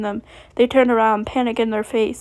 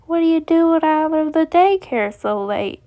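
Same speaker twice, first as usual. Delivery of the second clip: higher-pitched put-on Southern accent; 6 semitones higher, 4 dB louder than usual. A narrator's reading. Monty asking Chi-Chi why she's out, Southern accent. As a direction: question a kid in a Southern accent. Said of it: "in his thick Southern accent".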